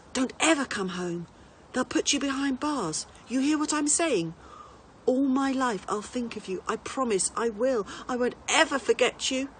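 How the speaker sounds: put-on a boy begging his lion; child's tender farewell; tearful farewell. A narrator's reading. Boy says goodbye to lion.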